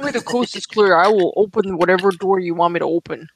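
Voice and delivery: normal talking voice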